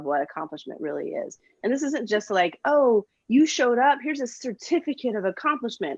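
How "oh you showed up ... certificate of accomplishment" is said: mock praise. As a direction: hand out a fake gold star. Mocking hollow praise.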